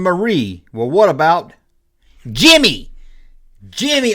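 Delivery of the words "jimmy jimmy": dramatic reveal. Acted.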